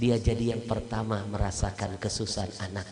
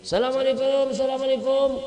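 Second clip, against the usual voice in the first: louder, higher greeting called through a door; calling greeting; 14 semitones higher, 7 dB louder, faster than usual. Acted. Doctor calls from outside the door, loud and high. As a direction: call out to be heard inside.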